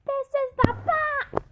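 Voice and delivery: ordinary delivery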